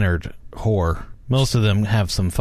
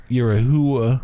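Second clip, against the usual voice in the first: Long Island accent. Shown as a demonstration. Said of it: "you're not good at accents".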